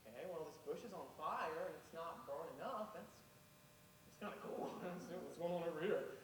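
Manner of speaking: hushed, wondering inner voice; a lightly voiced curious Moses; Moses musing quietly. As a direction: muse softly to yourself. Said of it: "and he's thinking".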